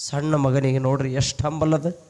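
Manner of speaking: ordinary talk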